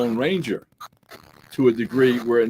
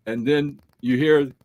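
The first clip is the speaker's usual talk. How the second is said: ordinary talk, nothing marked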